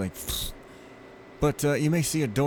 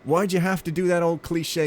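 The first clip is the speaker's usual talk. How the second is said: exasperated; 6 semitones higher, 4 dB louder than usual. Retold or imitated. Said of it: "I'm like".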